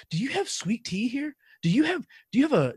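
high-pitched imitation of a small girl; curious six-year-old, voiced high; little child asking. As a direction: ask the waiter in a little kid's high voice. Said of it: "she'd be six or seven".

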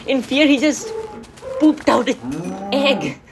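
breaking up with laughter while talking; laughing. Real, not acted. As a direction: gasp it out through helpless amusement. Amused by chicken laying an egg.

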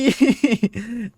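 high, loud exasperated laugh; burst of laughter. Real, not acted. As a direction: laugh out at the failed trap. Laughs after monster won't fall. (หัวเราะเสียงดัง)

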